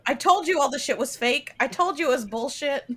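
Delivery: high, loud told-you-so mock voice; hammed-up I-told-you-so; mocking cultist. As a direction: jeer it like a smug relative.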